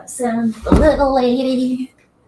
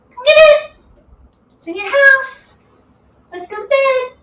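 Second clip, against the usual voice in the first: much higher sing-song coaxing; high pet-coaxing voice; 13 semitones higher than usual. Real, not acted. Owner calling her chinchilla to bed in a much higher coaxing voice. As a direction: lure the pet into its cage.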